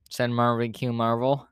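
usual speaking voice

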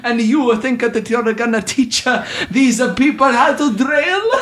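high-pitched cartoon Italian accent; ridiculous Italian accent. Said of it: "with a ridiculous Italian accent".

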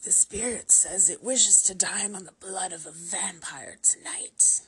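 loud, raspy, over-the-top medium's cry; almost shrieking cry. A narrator's reading. Victoria crying out the spirit's wish for blood, almost shrieking. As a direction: cry out in a forceful harsh rasp. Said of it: "cried out, almost shrieking now".

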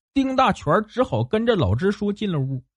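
usual speaking voice

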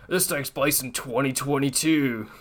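lightly put-on soldier voice; a hint of a serious military soldier; Ghost voice. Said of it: "That was a good Ghost voice".

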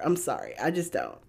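ordinary talk

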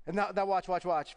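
normal talking voice

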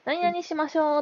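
high-pitched teacher's instruction; kindergarten teacher imitation. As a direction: mimic a teacher addressing small children. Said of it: "先生が". (幼稚園の先生の高い声の物まね)

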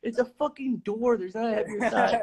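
high, fed-up comeback; exasperated retort. Retold or imitated. Speaker snaps back at friend.

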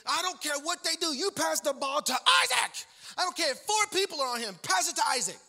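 high, loud coach's orders, peaking on the name; worked-up coach. Retold or imitated. Coach to team before taking the court, shouting orders. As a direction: imitate a coach yelling a game plan. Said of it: "the number four seed coach was just".

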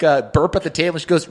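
usual speaking voice

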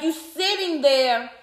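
normal talking voice

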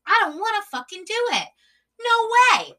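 loud, high, exasperated outburst; emphatic refusal. Real, not acted. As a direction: refuse point-blank, voice shooting up. Emphatic refusal of such dating.

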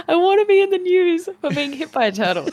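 high-pitched, laughing through the words; laughing admission. Real, not acted. Joking to co-host about wanting turtle fame, laughing. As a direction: confess it jokily, voice climbing with laughter.

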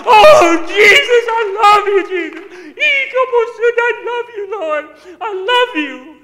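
very high, full-force shouted prayer, imitating her; crying out to God. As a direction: wail praise at the top of your voice. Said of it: "Just crying out to the Lord".

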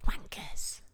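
voiceless whisper; real whispered insult. Real, not acted. Co-host joins in about banging neighbour, whispered insult. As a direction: swear so the neighbour can't hear.

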